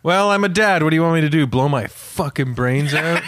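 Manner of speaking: ordinary delivery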